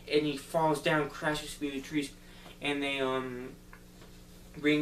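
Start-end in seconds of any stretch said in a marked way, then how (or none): none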